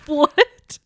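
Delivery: normal talking voice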